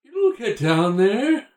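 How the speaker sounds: high, slow spooky ghost; ghostly voice. Pretend. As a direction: plead like a ghost from beyond. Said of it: "Matt's ghost".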